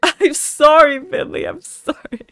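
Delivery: high, loud laughing I'm sorry; giggling apology through laughter; apologising while cracking up. Real, not acted.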